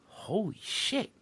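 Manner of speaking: slow, soft awed curse; stunned cousin. Retold or imitated. Cousin seeing the card, slow soft awe. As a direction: let it out slowly, stunned. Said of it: "And he goes".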